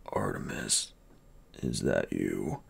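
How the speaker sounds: put-on dying bodyguard gasping to his young master; weak gasping. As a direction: gasp each word out, barely there. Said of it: "the words coming in short gasps".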